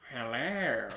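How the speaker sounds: lightly voiced cat person; a hint of a cartoon cat greeting. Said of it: "A cat voice is like".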